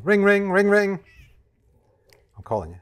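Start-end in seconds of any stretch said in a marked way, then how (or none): 0.0-1.0 high sing-song ring ring; phone ring imitation. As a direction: mimic a ringing phone, playful. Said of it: "Go on then, answer the phone".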